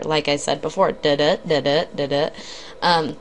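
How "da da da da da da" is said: da-da chanted low, weak then strong beat; iambic da-da rhythm. Shown as a demonstration. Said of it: "like we're reading an iambic pentameter".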